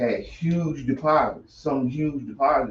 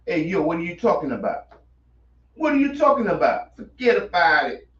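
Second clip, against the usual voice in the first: hammed-up New York mobster accent, higher; mafia impersonation; 5 semitones higher than usual. Host doing his best mafia voice for cohost. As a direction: play a wiseguy brushing it off. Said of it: "my best mafia impersonation".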